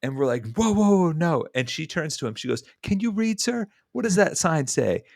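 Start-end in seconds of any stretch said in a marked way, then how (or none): 0.6-1.4 protesting bystanders. Retold or imitated. Bystanders object to the accusation. 2.8-5.0 high, pointed retort; sassy challenge. Retold or imitated. Woman telling off the man who cut in, sassy. She confronts him, retold.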